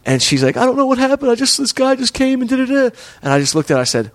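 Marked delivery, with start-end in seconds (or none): 0.6-2.9 high-pitched imitation of a crying girl; put-on weepy falsetto; mock tearful girl. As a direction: mimic her tearful pleading, way up high. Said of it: "sort of crying".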